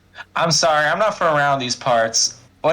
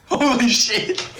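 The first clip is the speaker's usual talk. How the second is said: laughing; 10 semitones higher, slower than usual. Real, not acted. Player delighted by the can.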